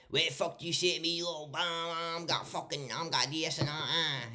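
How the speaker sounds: high, fast Glaswegian rant; broad Glasgow accent. Retold or imitated. Showing how broad Glasgow people talk, high broad Glasgow accent. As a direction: rattle off mock Glaswegian, pitched high. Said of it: "Glasgow is the broadest Scottish accent".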